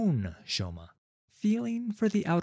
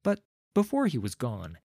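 plain reading voice

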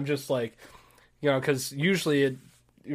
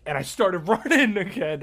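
usual speaking voice

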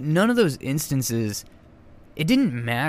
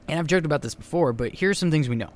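normal talking voice

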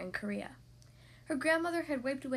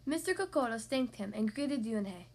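ordinary delivery

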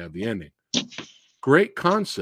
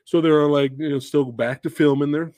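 ordinary talk, nothing marked

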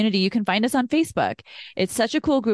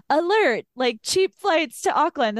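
higher-pitched mock alert; email alert; 6 semitones higher than usual. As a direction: announce it like a notification popping up.

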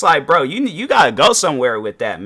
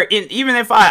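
normal talking voice

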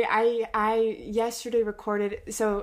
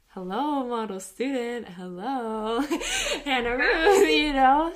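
laughing through happy tears; proud tears; 4 semitones higher, 5 dB louder than usual. Real, not acted. Gushing proud praise of friend.

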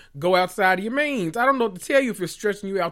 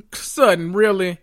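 ordinary talk, nothing marked

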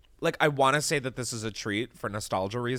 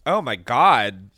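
disgusted groan; 3 semitones higher, 6 dB louder, much slower than usual. Real, not acted. Reacting to spicy beer.

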